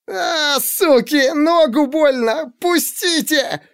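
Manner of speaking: a caught man howling at the police holding him; yelling in pain. A narrator's reading. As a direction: yell out, hurt and furious. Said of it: "орал Черёмушкин".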